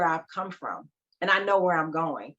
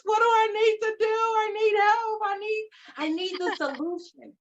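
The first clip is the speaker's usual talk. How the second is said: desperate plea; 13 semitones higher than usual. Retold or imitated. Acting out the point where she begged for a solution, mock desperate plea. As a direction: beg in a hammed-up high wail.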